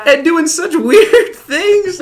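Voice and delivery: slow, high, laughing through the words. Real, not acted. Telling friends about his rowdy past, laughing. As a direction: crack up over the memory.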